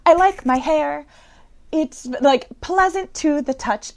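put-on a spacey boy admiring his own hair; lightly voiced spacey speller; dreamy kid voice. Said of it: "he's like".

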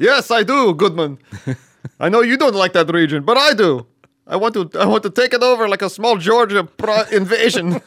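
loud, high hammed-up vampire count; Transylvanian count voice. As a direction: boom it out like a campy Dracula. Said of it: "Putin, or Count voice".

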